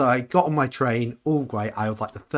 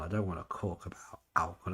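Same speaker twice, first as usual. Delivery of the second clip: hushed unvoiced aside; whisper; 3 semitones lower, 9 dB quieter than usual. Real, not acted. Vlogger whispering about the con she'd rather forget. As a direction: whisper it like a secret you'd rather drop.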